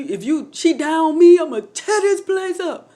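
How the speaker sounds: high, heated mock threat; worked-up retold outburst; husband's angry threat. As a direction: imitate an upset man making threats. Retelling husband threatening the doctors.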